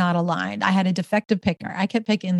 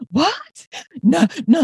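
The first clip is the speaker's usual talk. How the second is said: shocked denial; 8 semitones higher, slower than usual. Retold or imitated. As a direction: refuse it in stunned disbelief. Denying she is angry.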